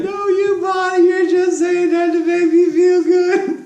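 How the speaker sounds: high, loud, wailing mock-sob; imitated crying protest. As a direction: wail it through tears like a child. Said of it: "stop crying".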